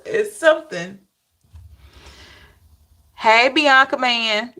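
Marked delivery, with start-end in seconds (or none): 3.2-4.5 slow, drawn-out Hey, Bianca Man; slow drawn greeting. Shown as a demonstration. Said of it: "that's how I'ma say it".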